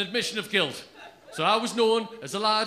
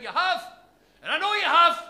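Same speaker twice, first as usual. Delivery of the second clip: high, insistent accusation; accusing shop lad; 9 semitones higher, 6 dB louder than usual. Retold or imitated. Shop lad accusing Paul, high insistent. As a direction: pin the blame on him in a high voice.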